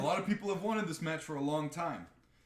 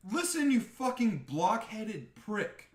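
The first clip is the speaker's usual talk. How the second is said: slow, higher, emphatic insult; annoyed retort; 8 semitones higher, 4 dB louder, slower than usual. Retold or imitated. Replying to the podcaster, slow scornful put-down. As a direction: lean into each word with contempt. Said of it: "And I'm like".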